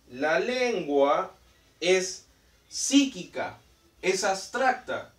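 slow, word by word, each term weighed; teacherly slow stress; slow emphasis. Shown as a demonstration. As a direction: spell the definition out for the class. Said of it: "teacher stressing a definition".

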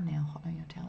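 soft whispered check of a phrase; muttered rereading. Real, not acted. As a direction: whisper it over to yourself.

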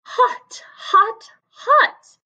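from a breathy whisper up to a high, loud cry; building hot hot hot. Acted. Dramatic story opening.

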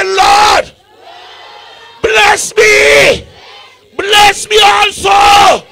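high, loud, slow cry of "Lord, bless me"; shouted call and response. Shown as a demonstration. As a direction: lead the congregation in a shouted plea. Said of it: "Somebody say".

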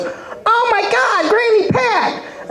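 put-on very high, drawn-out child's squeal; daughter's horrified cry. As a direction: squeal it slowly like a shocked kid. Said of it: "my daughter goes".

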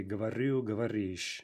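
говорю and говоришь stressed on last syllable; end stress. Shown as a demonstration. Teacher giving the key forms, говорю, говоришь stressed on endings. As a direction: hit the endings, гово-РЮ, гово-РИШЬ. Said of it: "we have in stress".

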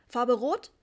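loud, impatient salesman's question. A narrator's reading. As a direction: push for an answer, rising sharply at the end. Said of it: "fragte der junge Mann ungeduldig". (ungeduldig und laut gefragt)

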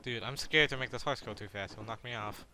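ordinary talk, nothing marked

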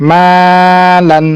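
"মালান" with a long held "মা" and short "লান"; stretched madd. Shown as a demonstration. Teacher repeating the word for students, "মা" stretched. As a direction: sustain the first vowel of "মালান" on a level note. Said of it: "মাকে টানলাম".

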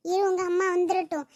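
usual speaking voice

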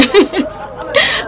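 breathy giggle up high; high airy laugh. Real, not acted. Vlogger laughing at her own endless praise. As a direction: laugh at yourself.

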